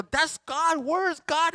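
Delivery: high, loud mock panic asking "where is God"; mock bewildered believer. Pastor mimicking a confused believer in hard times, high mock panic. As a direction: squeal it like you've lost him. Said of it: "and go".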